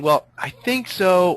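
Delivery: hesitant, drawn-out unsure answer; unsure clerk. Retold or imitated. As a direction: sound sheepish and indecisive. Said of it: "sheepish and indecisive and unsure".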